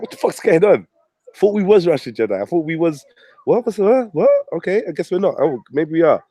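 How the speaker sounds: mock confusion, high and questioning; confused. Youngsters reacting to mixed messages, confused and high-pitched. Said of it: "They're confused".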